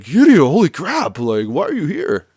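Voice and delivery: high, loud burst of disbelief; astonished greeting. Retold or imitated. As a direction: act out a stunned recognition, loudly. Yuya stunned at spotting Kiryu.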